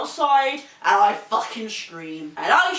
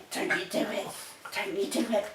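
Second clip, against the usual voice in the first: softer, breathy whispered warning; 7 dB quieter, faster than usual. Real, not acted. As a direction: hiss the warning quietly at the dog.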